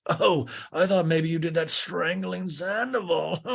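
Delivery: high imitated voice ending in laughter; [name]. [name] jokes with Greg about his hand, high mock laugh. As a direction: joke in a put-on high voice, then laugh.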